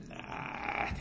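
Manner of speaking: barely voiced, very soft no; acted reluctant thought, almost without voice; dismissive murmur. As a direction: mumble a reluctant no to yourself, retelling it. Man dismisses a sudden thought.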